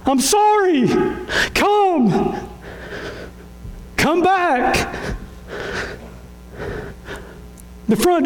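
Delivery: high-pitched, pleading preacher's cry; impassioned preaching. Real, not acted. Pastor apologising to those made unwelcome, impassioned. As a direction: cry it out high, drawing out short appeals.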